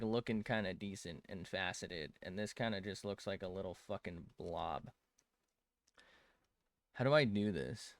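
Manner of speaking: usual speaking voice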